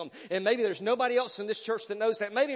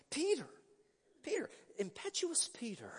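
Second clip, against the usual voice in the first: whispered aside; 6 semitones higher, 7 dB quieter than usual. Real, not acted. Preacher confides to the church about Peter, in a whisper. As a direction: whisper "Impetuous Peter" with breath only.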